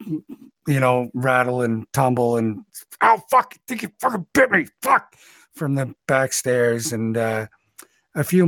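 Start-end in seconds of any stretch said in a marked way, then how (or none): 3.0-5.1 high, loud yelp of someone just bitten; pained hollering. Acted. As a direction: cry out in pain and swear, fast. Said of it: "and hollering".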